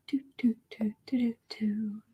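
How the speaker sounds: breathy unvoiced scrolling filler; whispered doo-doos. Real, not acted. Host scrolling the chat, whispering filler. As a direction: tick through the doo-doos under your breath.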